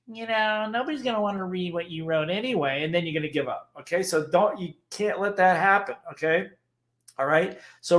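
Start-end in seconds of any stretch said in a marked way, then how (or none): 0.1-2.8 lightly voiced discouraging inner critic, slightly softer; a hint of the sneering little voice. Speaker imitates the voice that makes writers quit, mildly put-on. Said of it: "your little voice chomps in and goes".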